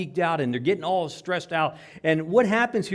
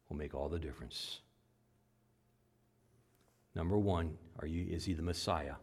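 soft solemn preaching; 7 semitones lower, 6 dB quieter than usual. Real, not acted. Preacher quietly closing his sermon appeal.

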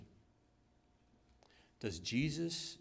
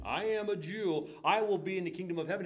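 a touch of the proud believer; 6 semitones higher, 5 dB louder than usual. Retold or imitated.

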